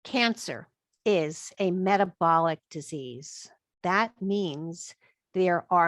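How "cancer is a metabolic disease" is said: deliberate repeat. Shown as a demonstration. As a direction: space out "Cancer is a metabolic disease", word by word. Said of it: "hear that one more time".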